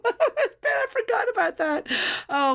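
high, slow, laughing through the words. Real, not acted. Laughing at a forgotten celebrity wedding memory. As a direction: giggle it out, high and drawn.

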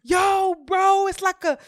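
high, loud, hyped-up bro; imitated going in. Boy raving to his friend on the phone, hyped-up imitation. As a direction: holler it like you can't believe it. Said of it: "yo, yeah, he was going in".

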